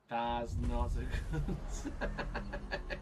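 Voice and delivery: drawn-out words breaking into laughter; slow, helpless laughing realization; laughing in dismay. Real, not acted. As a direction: laugh helplessly as it goes wrong. Laughing in dismay at failed hardware.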